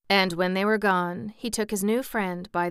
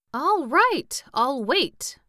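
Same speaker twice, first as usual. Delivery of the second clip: raised, louder grudging agreement; surly; 8 semitones higher, 7 dB louder, much slower than usual. A narrator's reading. Pumpkinhead told to wait for the interpreter, surly. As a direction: give in with loud, high-pitched surliness. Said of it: "replied the Pumpkinhead in a surly tone".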